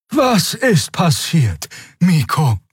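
put-on high, slow old creature voice; ancient creature. A narrator's reading. Worried ancient Draxyl asks the boy what happened, old creature voice. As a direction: draw the question out high, as an ancient being.

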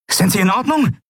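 high-pitched urgent check on the wounded; urgent concern. A narrator's reading. Bodyguard leader asks wounded Kai if he is okay, urgent.

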